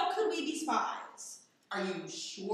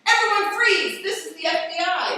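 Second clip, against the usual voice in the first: much louder shouted order, higher; police command; 4 semitones higher, 10 dB louder than usual. Acted. FBI agent bursting into the right room, shouting. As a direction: storm in and own the room.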